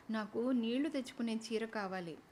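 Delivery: plain reading voice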